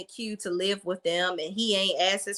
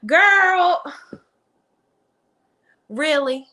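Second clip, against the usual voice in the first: loud disbelief; 7 semitones higher, 7 dB louder, much slower than usual. Real, not acted. Reacting to Karen's line.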